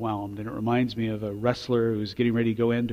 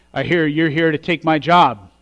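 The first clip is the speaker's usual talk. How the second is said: mock challenge; 5 semitones higher, 12 dB louder than usual. Upperclassman challenges the new recruit.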